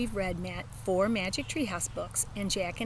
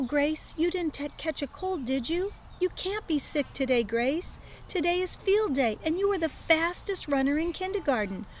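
put-on child voice; 8 semitones higher than usual. Child worried on phone.

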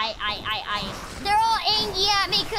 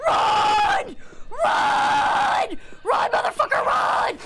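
frantic yelling to run; panicked shouting; slower than usual. Real, not acted. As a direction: scream run over and over. Fleeing the dragon, yelling.

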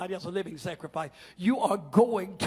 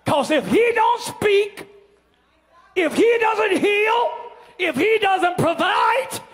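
slow, shouted, higher climb of the preacher; preaching climax; 5 semitones higher, 11 dB louder, slower than usual. Acted. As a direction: build it to a roaring climax, word by word.

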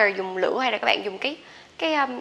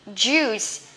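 normal talking voice

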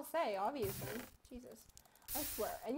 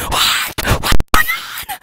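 high, loud startled yelling; shocked shout; 26 dB louder, slower than usual. Real, not acted. Shouting in shock at what just happened in the game. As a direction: yell in sudden shock.

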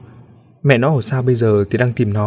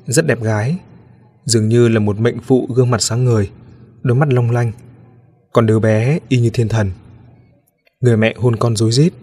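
usual speaking voice

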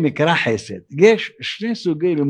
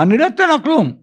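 high-pitched, emphatic refusal; mock outrage, pitched way up; acted indignant refusal; 10 semitones higher, faster than usual. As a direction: refuse as if fed up with a beggar.

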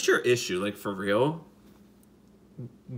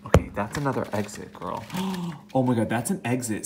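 ordinary talk, nothing marked

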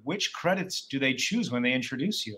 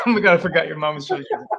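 laughing; 3 semitones higher, 7 dB louder, much faster than usual. Real, not acted. Laughing realization about host's mom.

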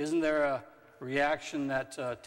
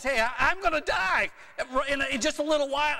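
mock urgent announcement; 12 semitones higher, 6 dB louder, faster than usual. Dad announcing own death, retold.

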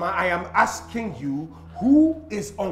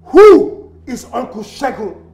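loud, raised voice, heavy on WHO; furious demand; 4 semitones higher, 13 dB louder than usual. Acted. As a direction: punch out WHO, then the rest in anger. Son demands answer once more.

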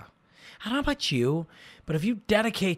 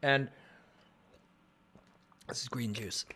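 usual speaking voice

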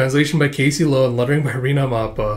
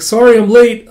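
cheery call; 8 semitones higher, 9 dB louder than usual. Acted. Girl bursts in cheerfully.

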